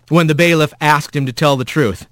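ordinary delivery, nothing marked

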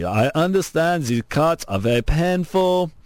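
hammed-up Austrian accent impression; badly faked Austrian accent. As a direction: imitate the governor in a clumsy Austrian accent. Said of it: "it's my bad accent".

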